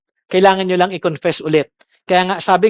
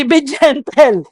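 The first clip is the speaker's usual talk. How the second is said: gentle laughing; 5 semitones higher, slower than usual. Shown as a demonstration. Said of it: "So, he-, be".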